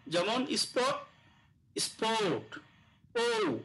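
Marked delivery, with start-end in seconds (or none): 3.2-3.6 "ও" pitched high and drawn out; showing the rounded vowel; long o demo. Shown as a demonstration. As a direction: float "ও" up high and hold it. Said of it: "মানে ও".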